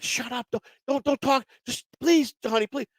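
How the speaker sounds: lightly voiced wife, high and hushed, begging him to stop; pleading wife. Husband imitating his wife begging him to stay quiet during arrest. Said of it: "and she's like".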